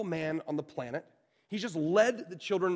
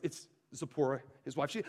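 ordinary talk, nothing marked

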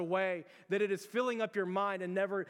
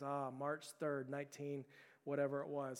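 low muffled recitation; 3 semitones lower, 6 dB quieter than usual. Retold or imitated. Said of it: "he's speaking out loud through the bag".

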